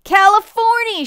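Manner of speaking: very high, loud holler; joyful shout. A narrator's reading. Driver announcing arrival to the passengers, high shout. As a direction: call out the arrival at full voice, high and sustained. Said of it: "shouted the driver".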